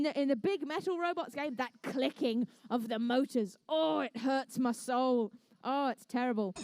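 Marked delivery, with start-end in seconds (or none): none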